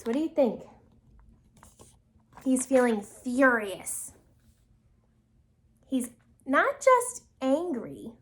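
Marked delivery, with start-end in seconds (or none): none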